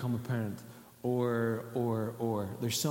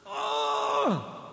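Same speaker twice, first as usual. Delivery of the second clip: mock anguished oh; 3 dB louder than usual. Gasp after crying out.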